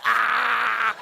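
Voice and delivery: loud laughing shout; friends' jeering whoop. Retold or imitated. Friends mocking him through the glass, laughing shout. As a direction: whoop like teasing schoolmates.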